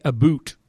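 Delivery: exaggerated Canadian "a boot", rhyming with "shoot"; mock Canadian pronunciation. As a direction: say "a boot" for "about", with an oo vowel. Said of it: "at least we're not Canadians".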